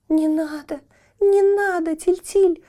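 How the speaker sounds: высокий отчаянный вскрик, нарастая; умоляет в отчаянии, голос выше; отчаянно. A narrator's reading. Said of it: "отчаянно заплакала Метель". (Метель в отчаянии умоляет Тильтиля, высоко)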